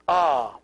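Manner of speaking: ah as an open, held vowel; letter sound demo. Shown as a demonstration. Teacher shows how alpha sounds, a clear held ah. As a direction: sound out ah on its own. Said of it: "it's always pronounced".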